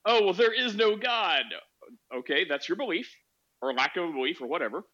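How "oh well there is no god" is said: higher, slow mocking quote; mock atheist. Host mimics the atheist declaring her belief, mocking. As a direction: imitate her with a drawn-out, raised pitch. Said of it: "Just because she's like".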